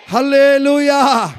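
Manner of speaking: slow ringing shout of hallelujah; drawn-out hallelujah. Real, not acted. Preacher rousing the congregation, slow drawn shout. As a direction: stretch it out and shout it.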